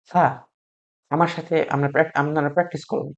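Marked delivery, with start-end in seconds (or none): 0.0-0.5 "সা" with air released at the tongue tip; tongue to the upper front teeth, let air out on "সা"; airy consonant. Shown as a demonstration. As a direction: say "সা" with a thin, airy onset. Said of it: "সেখান থেকে বাতাস বের করে দেবো".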